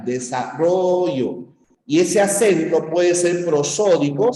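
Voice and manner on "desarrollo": "Desarrollo" slow, with the stressed syllable "rro" pushed; stressed demo word. Shown as a demonstration. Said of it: "es el acento de la palabra".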